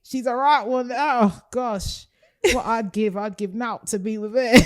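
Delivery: swooning Geordie lad, slowed on worldie; mock Geordie accent. Host playing a Geordie lad swooning over a girl, in hammed-up Geordie. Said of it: "we have to do that accent".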